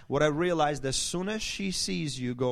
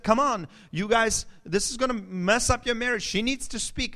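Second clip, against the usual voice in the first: higher, louder exasperated counselor; imitated counselor; 7 semitones higher, 5 dB louder than usual. Counselor warning a couple about their marriage, exasperated imitation. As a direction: urge the couple, pitched high and emphatic. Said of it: "And so he said".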